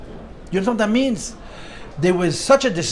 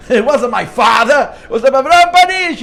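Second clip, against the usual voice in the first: put-on loud, high Brooklyn-accented protest; 5 semitones higher, 9 dB louder than usual. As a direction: insist in a Brooklyn accent, louder and higher. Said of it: "I can hear that New Brooklyn accent".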